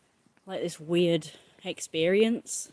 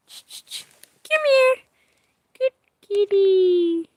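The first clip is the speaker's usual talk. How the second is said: high, loud drawn-out call; 13 semitones higher, 7 dB louder, much slower than usual. Real, not acted. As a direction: call the kitty up high, slow. Calling the cat.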